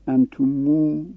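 "انتمو" with the damma stretched into a long u at the end; "انتمو" spoken as antumu with the closing vowel drawn out; long final u. Shown as a demonstration. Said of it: "با ضم با واو هم یه تلفظشه".

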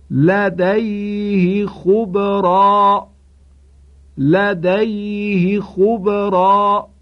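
لدیه with short ha, very slow chant; Qur'anic recitation. Shown as a demonstration. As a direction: chant لدیه خبرا slowly, no elongation. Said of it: "توجه کنید". (تلاوت کشیده و آرام لدیه خبرا)

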